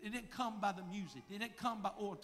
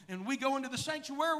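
ordinary talk, nothing marked

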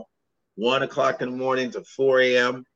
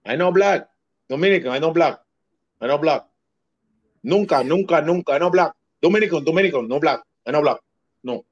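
Dominican denial impression; faster than usual. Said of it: "you did the impression".

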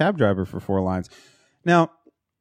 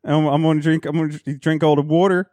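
higher-pitched hammed-up Philly accent; 5 semitones higher than usual. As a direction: keep up the joking Philly local act. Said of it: "I'd be like".

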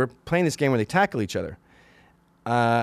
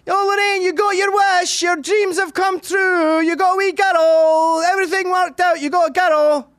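mocking dad; 6 dB louder than usual. Dad taunting son as the girl mother wanted, high mocking shout. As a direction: taunt him with hammed-up shouted congratulations. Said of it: "my dad would go".

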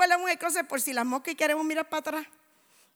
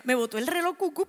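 ordinary delivery

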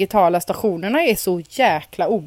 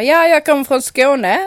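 put-on higher, louder Skåne accent; fake Skåne accent; 6 semitones higher, 7 dB louder than usual. Boy answering the Skåne teacher, in a put-on Skåne accent. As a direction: bluff it in a loud Skåne accent. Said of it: "På skånska".

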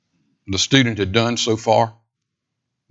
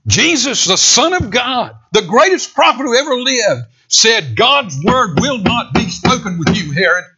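much higher, loud ringing proclamation; preaching at full force; 11 semitones higher, 4 dB louder than usual. Acted. Preacher proclaiming Jesus' verdict on Herod, ringing and loud. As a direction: proclaim it at the top of the voice.

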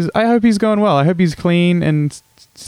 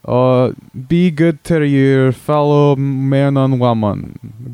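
hammed-up Scottish brogue; broad comic Scottish accent. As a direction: play a crude Scotsman caller on the radio. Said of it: "crude impression of a Scotsman".

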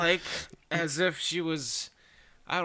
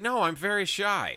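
high, slow coy impression; mock coy falsetto; mock shy voice; 7 semitones higher, 3 dB louder, slower than usual. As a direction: play it high and bashful. Woman voiced in retold scene.

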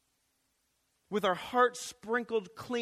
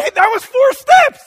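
much higher and louder, thrilled squeal; excited parent; 18 dB louder than usual. Pretend. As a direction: squeal with delight at baby's first steps. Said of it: "And you're like".

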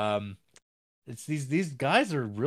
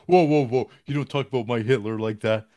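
loud, hammed-up indignant German; a loud, hammy protest defending the leader; mock offended outburst; 10 dB louder than usual. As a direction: blow up in fake outrage at an insult. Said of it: "And they're like".

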